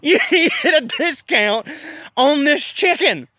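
cracking up mid-line, high and slow; can barely get the words out for laughing; giggling too hard to say it; laughing through it. Real, not acted. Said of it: "I can't even say it".

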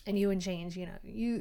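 usual speaking voice

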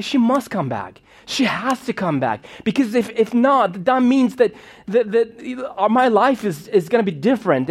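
put-on a kid trying to convince himself; distressed child. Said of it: "I was so distressed".

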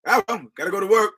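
mock nonchalant shrug. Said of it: "shrugging off the news".

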